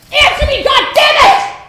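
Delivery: high, loud enraged yell; furious shout. Acted. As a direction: scream it at her in rage. Drunk father yelling at daughter.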